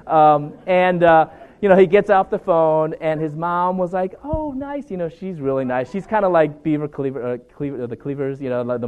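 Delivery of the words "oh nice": high, sweet mock-mom voice; imitating his mom. Retelling a chapel story, the roommate's mom answers in a high, sweet mock-mom voice. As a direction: pitch way up like a sweet sitcom mom.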